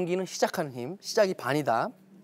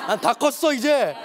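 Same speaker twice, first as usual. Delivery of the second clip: mock proud teen; 4 semitones higher, 7 dB louder than usual. Teen self voiced proudly.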